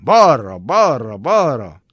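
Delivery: rhythmic marching chant; Mayakovsky recitation. Acted. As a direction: declaim it like a poem's marching refrain, each beat falling. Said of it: "ahogy Majakovszky mondja".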